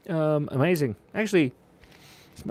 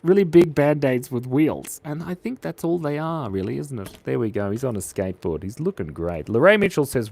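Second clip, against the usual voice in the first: ordinary talk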